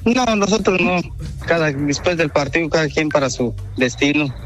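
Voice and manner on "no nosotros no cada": normal talking voice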